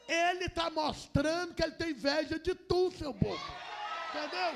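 loud, rising preaching shout. Real, not acted. Preacher shouting at the church about envy, loud and fervent. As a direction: hammer the point home at full voice.

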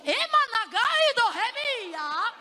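high, stretched cry; loud ecstatic exclamation; fervent preaching outburst. Real, not acted. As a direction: burst out in pulpit fervour.

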